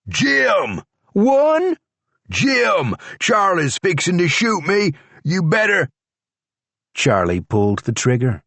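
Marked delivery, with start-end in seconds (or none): 0.1-5.9 higher, urgent yell; panicked calling out. A narrator's reading. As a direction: shout for the sheriff, pitched up. George screaming for help.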